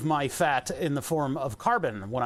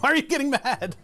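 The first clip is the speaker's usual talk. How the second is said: much higher, louder hurt protest; mock indignant question; 11 semitones higher, 5 dB louder than usual. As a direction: play up your own shock at the doctor.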